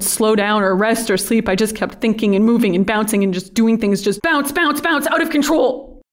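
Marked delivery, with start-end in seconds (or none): 4.0-6.0 higher, wound-up rush of words; agitated, high-pitched repetition; restless spiral. Acted. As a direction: let it spiral upward, bouncing out of control. Manic spiral of restless monologue.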